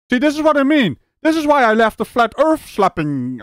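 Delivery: high-pitched exasperated outburst. Real, not acted. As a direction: vent in real, incredulous frustration. Exasperated reaction to flat-earth claim.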